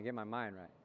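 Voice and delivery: low, soft mantra again repeated; steady it inward. Shown as a demonstration. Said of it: "Say it again".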